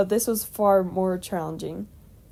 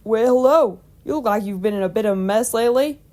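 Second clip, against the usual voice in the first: hearty, louder country farmer; chuckling old farmer; 3 semitones higher, 7 dB louder than usual. A narrator's reading. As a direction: greet them loudly as a jovial old farmer. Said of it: "chuckled the old farmer".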